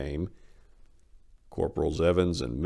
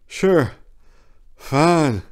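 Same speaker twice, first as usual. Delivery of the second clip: strained throat; 10 semitones higher, 9 dB louder than usual. A narrator's reading. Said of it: "squeeze through my tortured throat".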